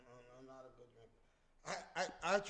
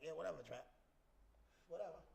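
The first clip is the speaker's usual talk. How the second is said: dismissive; 9 dB quieter, faster than usual. Retold or imitated. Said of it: "He'd be like".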